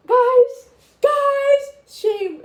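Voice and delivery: high, loud cry of embarrassment; embarrassed cry. Real, not acted. Telling viewers about her mom's text, loud embarrassed cry. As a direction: wail it to the viewers.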